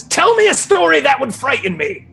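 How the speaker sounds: high, imperious demand; demanding examiner. Acted. Examiner flying over to Draco for a test, commanding.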